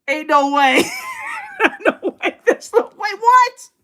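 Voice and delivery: high, loud, laughing refusals; squealing no-way through laughter; laughing disbelief. Real, not acted. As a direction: laugh it off as impossible. Disbelief at being fired over politics.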